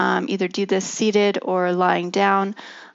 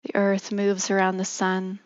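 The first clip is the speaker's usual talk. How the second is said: guided meditation. Acted. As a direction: ease them gently into stillness.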